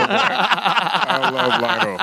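high, drawn-out words through laughter; real giddy laugh in the voice; laughing affection. Real, not acted. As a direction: stretch it out while cracking up. Laughing fondly after the story.

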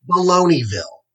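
high, dismissive scoff; scornful snort. A narrator's reading. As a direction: scoff it off as nonsense. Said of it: "snorted Hawkeye".

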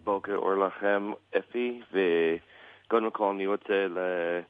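American-accented Hebrew; english accent in hebrew. Real, not acted. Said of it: "שהעברית שלי לא כל כך טובה". (עברית במבטא אמריקאי כבד)

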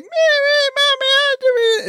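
hammed-up little girl voice; mock little kid voice. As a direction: mimic a small child babbling. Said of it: "He talks like".

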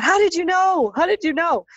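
stunned disbelief, asking twice; astonished. Retold or imitated. Husband to me, a high astonished question. Husband amazed she knew.

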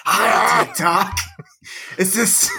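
high mock-seductive voice; mock raspy voice. Shown as a demonstration. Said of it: "you just sent shivers down".

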